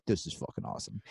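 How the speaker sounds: breathless whispered awe. Retold or imitated. Thrilled while running.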